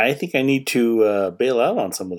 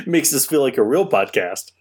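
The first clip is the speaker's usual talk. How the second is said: laughing; 4 semitones higher than usual. Real, not acted. Laughing about feeling like a real podcast.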